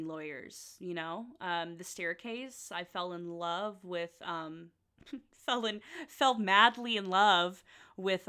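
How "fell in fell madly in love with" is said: louder, drawn-out swoon; swooning over the word madly; gushing. Real, not acted. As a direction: gush it like a schoolgirl crush. Gushing over the Staircase lawyer.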